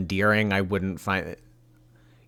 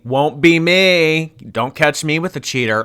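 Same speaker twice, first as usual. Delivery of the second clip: mock defiant vow; 6 semitones higher, 5 dB louder than usual. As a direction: stretch out the vow in mocking emphasis. Mocking her vow by repeating it.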